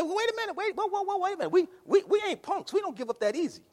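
a hint of the crowd pushing back; mock protesting heathen. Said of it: "And the people say".

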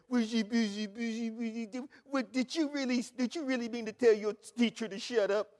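mocking a coddling parent; lightly voiced indulgent mother. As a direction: sweet-talk the child in parody. Mocking a soft-spoken parent.

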